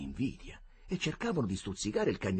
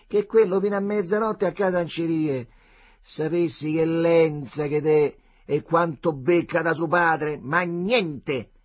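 complaining Roman mother; 8 semitones higher, 8 dB louder than usual. A narrator's reading. Sor Adele complaining about son.

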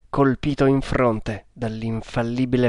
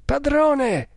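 alarmed exclamation; 13 semitones higher than usual. A narrator's reading. Servant to his master after lightning strikes, high exclamation. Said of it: "esclamò Kammamuri".

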